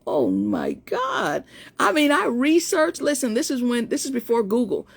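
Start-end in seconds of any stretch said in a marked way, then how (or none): 0.1-1.4 low, slow mortified murmur; dismayed mutter. Retold or imitated. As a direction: shrink into it, slowly. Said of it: "I'm like".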